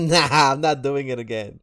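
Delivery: high loud laughing refusal. Real, not acted. Laughing off the suggestion.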